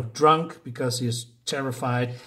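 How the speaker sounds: normal talking voice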